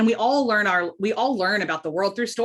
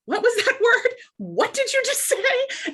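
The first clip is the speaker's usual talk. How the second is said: incredulous questioning. Retold or imitated. Wife quizzing husband on strange word, high-pitched disbelief. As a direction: squeal the question in playful disbelief. Acts out puzzlement at husband's words.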